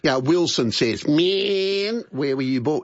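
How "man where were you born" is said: lightly imitating a bandmate, drawn-out "man"; a hint of a musician's voice, hammed up on "man". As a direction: do a rough mock impression of a bandmate. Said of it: "terrible impression".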